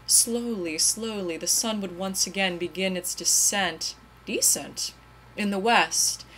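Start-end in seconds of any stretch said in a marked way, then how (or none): none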